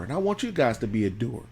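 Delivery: normal talking voice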